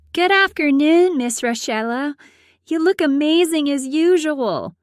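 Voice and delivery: put-on high, bright flattering girl voice; butter her up, pitched well up; Shelby's sweet greeting. A narrator's reading.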